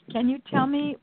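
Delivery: ordinary delivery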